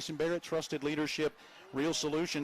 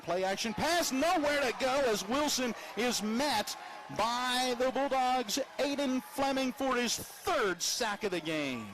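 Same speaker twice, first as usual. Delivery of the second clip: rising excitement, stretch the words; excited call; 8 semitones higher than usual. Real, not acted. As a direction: voice climbs high as the sack hits. Sack called in live play-by-play.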